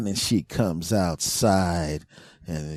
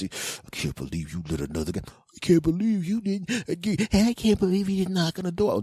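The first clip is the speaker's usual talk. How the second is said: high accusing voice; 9 semitones higher than usual. Retold or imitated. Girlfriend voiced in argument.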